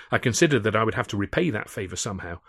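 plain reading voice

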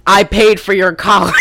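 mock parental shout; hammed-up guilt-trip yell; Steve Lesh. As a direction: bellow it like an outraged dad. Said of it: "He's gonna pull a Steve Lesh".

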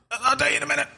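strained high squeak, holding breath; breathless strained voice. Retold or imitated. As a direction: talk while holding your breath. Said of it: "Don't even ask me a question".

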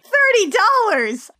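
high, drawn-out exclamation; mock outrage. Recapper scoffing at the dealer's price, high mock outrage. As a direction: exclaim it in hammed-up disbelief.

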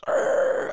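growled engine-rev noise; rough mouth-made engine sound; imitated car roar. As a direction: mimic a car revving past. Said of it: "All I hear is like".

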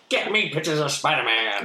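barking newspaper editor. Retold or imitated. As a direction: bark orders like a gruff editor. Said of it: "J. Jonah Jameson from Spider-Man".

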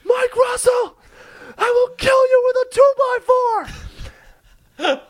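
high, wild, raging shriek; screaming like a wild animal. Retold or imitated. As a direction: scream the threat at full force. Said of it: "he screamed".

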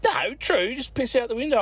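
high, mock-earnest mate insisting. Mate dares him to piss out the window, high mock insistence. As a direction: push the dare like it's gospel.

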